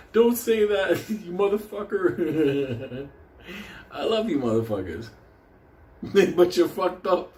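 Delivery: usual speaking voice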